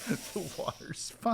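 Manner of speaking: soft unvoiced teasing whisper. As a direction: breathe it out slowly as a tease.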